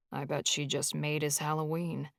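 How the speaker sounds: soft low aside; under his breath. Acted. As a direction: murmur it sideways, teasing. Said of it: "Charlie said under his breath".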